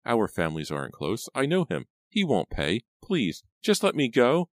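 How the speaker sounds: rising, high-pitched begging; desperate pleading. A narrator's reading. Captive says uncle won't pay, pleading desperately. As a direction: build from urgent protest to high plea.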